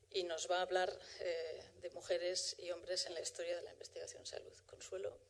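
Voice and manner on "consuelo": normal talking voice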